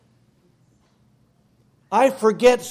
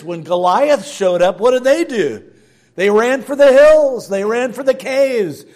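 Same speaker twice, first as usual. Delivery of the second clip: preaching louder and louder, pitch climbing; rising preaching; 4 semitones higher, 8 dB louder than usual. Acted. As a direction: build the sermon to a loud peak. Preacher building to full force.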